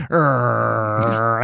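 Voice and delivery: long, held "rrr"; drawn-out rhotic growl; playful tiger growl. Acted. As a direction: growl like a tiger chasing kids. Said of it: "pretend I'm a tiger".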